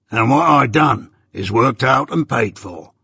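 a hint of a gruff old convict, settling the matter; lightly voiced old convict. Magwitch quoted mid-narration.

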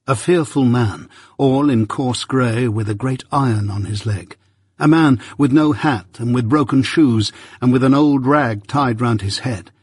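usual speaking voice

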